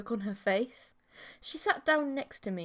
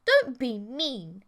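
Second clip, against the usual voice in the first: pouting; 2 semitones higher, 6 dB louder, slower than usual. Acted. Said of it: "she pouted".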